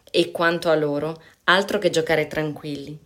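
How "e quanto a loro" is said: ordinary delivery, nothing marked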